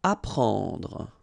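"Apprendre" said ah-PRAHNDR with a nasal en; "Apprendre" said the French way; French word. Shown as a demonstration. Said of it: "combination of e and n gives you this nasal".

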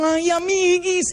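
put-on high girlish whimper; imitating girls comforting each other; scared girl. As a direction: coo it like a frightened schoolgirl to her friend.

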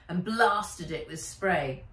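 usual speaking voice

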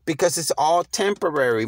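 ordinary talk, nothing marked

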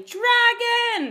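high, loud panicked yell; full-voiced scream of fright. A narrator's reading. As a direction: yell the alarm to everyone. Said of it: "the people were yelling".

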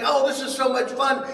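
high, gushing retold joy; giddy delight. Retold delight at fawning fans.

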